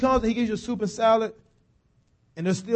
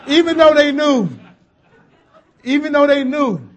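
laughing; 5 semitones higher, 7 dB louder than usual. Real, not acted. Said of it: "laughing repeat of the refrain".

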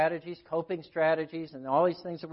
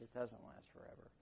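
whispered, gentle echo; soft reassurance; 4 semitones lower, 20 dB quieter than usual. Retold or imitated. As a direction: breathe it out softly, reassuring. Counselor gently reassuring client.

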